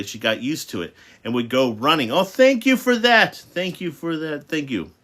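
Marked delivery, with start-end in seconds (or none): 2.1-3.4 high, loud, delighted thank you; excited thanks. Real, not acted. Reader thanking someone. 3.4-4.9 soft thanks. Real, not acted. Reader thanking again softly.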